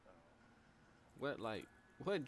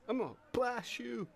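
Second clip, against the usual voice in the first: a put-on tough guy threat, higher than usual; imitating a thug voice; 7 semitones higher than usual. Streamer mocks the game character's tough voice. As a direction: mimic the character's tough voice, pitched up.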